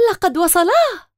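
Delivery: excited cry. A narrator's reading. Girl cries out as the guests arrive, excited. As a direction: call out that they've arrived. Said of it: "هتفت تمارا".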